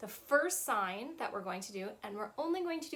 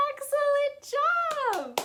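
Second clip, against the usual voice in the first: bright praise; 8 dB louder, much slower than usual. Acted. Praising children after song.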